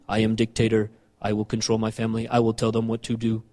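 low, monotone dictator voice; soft, robotic-sounding tyrant; mock dictator. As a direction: lay down the law in a low, quiet drone. Voicing a self-styled dictator husband.

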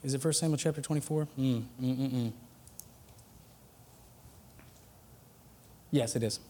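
half to yourself, hunting for the page; muttering to self. Real, not acted. Muttering while searching the scripture.

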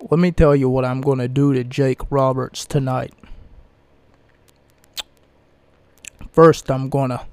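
put-on cocky Italian accent; Razor Ramon Italian accent. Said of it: "he used to talk in, like, this Italian accent".

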